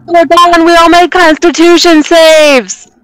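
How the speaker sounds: high-pitched mock holler; yelling down. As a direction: keep calling down from the mountaintop. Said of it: "I yell down to Florence".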